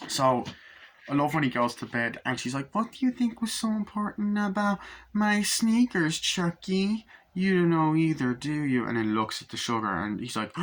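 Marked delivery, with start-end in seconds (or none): 2.7-8.8 mother voice. Retold or imitated. Mother questioning her son.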